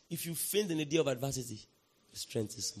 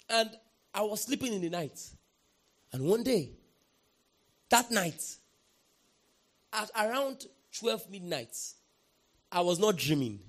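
normal talking voice

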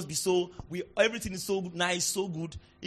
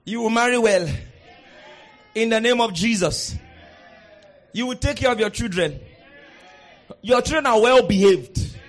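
preaching declarations; 8 dB louder than usual. Acted. Pastor declaring blessings over congregation, loud. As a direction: proclaim each blessing over them.

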